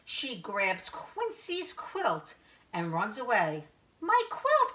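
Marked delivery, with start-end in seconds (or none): 4.0-4.7 high, loud cry of alarm; Quincy. A narrator's reading. Quail crying out as the dog takes the quilt, high alarm. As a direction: cry out high in storybook distress. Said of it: "cries Quincy".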